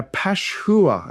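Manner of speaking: "Pashhur" said slowly as pash-hoo-r; two syllables. Shown as a demonstration. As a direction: separate "Pash" from "hur" in "Pashhur". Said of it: "you've got two syllables".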